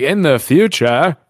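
hammed-up retro prediction voice; mock futuristic announcer. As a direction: announce it slowly and grandly, mocking. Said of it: "They're always like".

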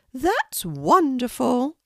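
a kindly Queen welcoming a guest, pitched well up. Acted. The Queen inviting a shy girl to tea, high regal voice.

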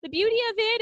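put-on high, dreamy old-aunt gush; mock Aunt Judy. Host imitates Aunt Judy praising Earth, high fluttery gush. Aunt Judy's line in recap.

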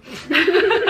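a quick high laugh after the joke; bright burst of laughter. Real, not acted. Joking she is the scam, bursts out laughing. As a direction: laugh out loud at your own punchline.

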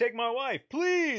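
put-on high, loud old-time comedian punchline; old borscht belt comic. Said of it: "no one knows those jokes".

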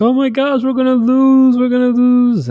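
high, loud mock panic; panicking mom. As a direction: shriek it like a mom who's sure they'll lose. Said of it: "my mom was screaming on the sideline".

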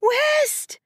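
very high distant-mother scream; screech. A narrator's reading. Mother across the aisle, screeching for her son. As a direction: screech the name across a cabin. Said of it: "Justine screeched from the other side".

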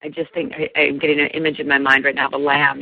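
ordinary talk, nothing marked

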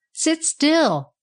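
high-pitched urgent order; firm command. A narrator's reading. Andirons grabbing Tom by the arms, firmly commanding. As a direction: hold him back with a high call.

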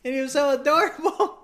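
very high-pitched gushing; gushing protest. Real, not acted. Defending the police constable dog to friend, gushing. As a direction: squeal it, overcome by cuteness.

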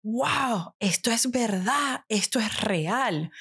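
hushed realization retold without a whisper; deeply moved awe. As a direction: recall being struck by it, drawing the words out. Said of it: "tan profundo y sentí".

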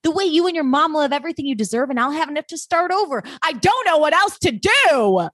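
high-pitched, hammed-up desperate outburst; desperate pleading. Acted desperate outburst from recap.

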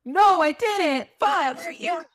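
high, near-crying panic; panicked. Real, not acted. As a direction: wail it out in real alarm. Panicking over forgotten sage.

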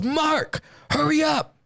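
high drawn call to an accomplice; mock holler; urgent calling. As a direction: call out the name impatiently, pitched high. Acting out Freddie calling Mark.

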